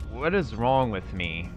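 ordinary delivery

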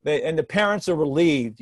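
ordinary talk, nothing marked

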